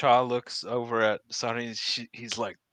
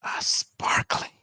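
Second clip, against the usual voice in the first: slow, airy whisper; hushed, drawn-out admiring whisper; awed whisper; much slower than usual. Acted. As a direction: whisper it slowly in wonder. Awed at the goods on show.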